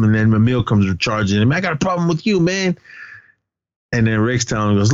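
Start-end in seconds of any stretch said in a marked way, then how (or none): 1.5-2.8 mock-aggressive, high-pitched challenge; retold confrontation. Emil charging at his classmate, mock aggressive.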